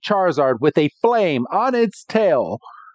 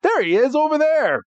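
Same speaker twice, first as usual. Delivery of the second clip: much higher-pitched mock excitement; acted excited spotting; 10 semitones higher than usual. Ash spots his Squirtle across the hotel, mock excited. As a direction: call it out high, as if just spotting a friend. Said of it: "he's like".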